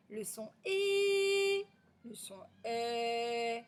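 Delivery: final ET and E held very long; elongated vowel sounds. Shown as a demonstration. Teacher gives pupils the sound choices, "et" and "e" drawn out. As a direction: stretch the vowel after each "le son". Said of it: "si vous entendez".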